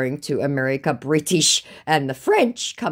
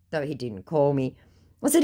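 ordinary talk, nothing marked

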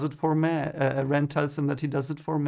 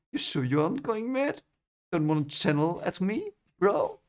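lightly higher, mock-nervous teasing; teasing [name]; 4 semitones higher than usual. [name] teasing Rand about going mad, mock-nervous. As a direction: poke fun at him with a grin. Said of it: "starting to make fun again".

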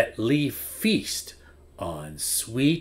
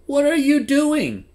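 high, loud shriek of a question; screamed; 6 dB louder than usual. Acted. Bee scolding the flea for eating nectar, screaming. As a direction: Bee screams it out in shock, much higher and louder. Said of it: "screamed Bee".

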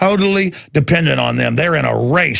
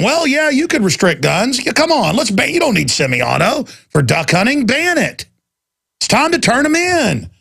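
mock Republicans; 7 semitones higher than usual. Mocking Republicans calling for gun bans.

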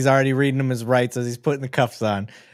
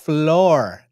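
floor said with a settled falling tune; confirming; 4 semitones higher, 5 dB louder than usual. Real, not acted. Vlogger confirming the officer's word, falling tune. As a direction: land on floor with certainty. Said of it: "Kraus called it".

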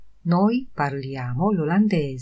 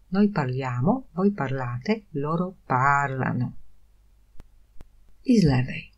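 normal talking voice

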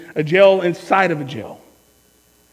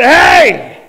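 loud, higher-pitched hey; sharp call-out; 5 semitones higher, 9 dB louder than usual. Retold or imitated. Calling after the man who called him Snowflake, loud challenge. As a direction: call him out with a sudden shout.

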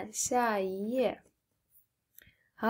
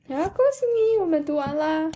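much higher, louder congratulations; excited cheer; 7 semitones higher, 5 dB louder, much faster than usual. Real, not acted. Cheering the child at the end.

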